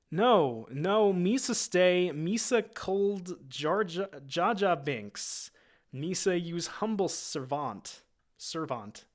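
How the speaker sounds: high, slow, pleading Gungan voice; Jar Jar voice. Acted. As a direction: beg to stay as a clumsy Gungan, drawn out.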